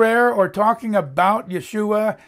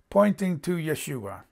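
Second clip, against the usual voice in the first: ordinary talk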